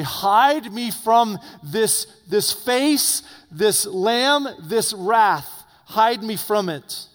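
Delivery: high, slow, loud pleading cry; terrified sinners crying to be hidden; desperate plea. Acted. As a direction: beg to be hidden, drawn out. The wicked begging to hide.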